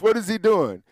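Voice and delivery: ordinary talk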